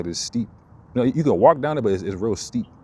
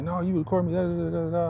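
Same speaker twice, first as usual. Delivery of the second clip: high accusing woman's voice, far higher; mocking accuser; 9 semitones higher than usual. Imitating the woman who accused him, high and sharp. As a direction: squeak out her accusation.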